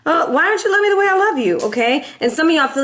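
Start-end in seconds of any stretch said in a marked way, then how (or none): 0.0-1.6 high whiny plea to a lover; mock pleading for love. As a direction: beg in a high put-on voice.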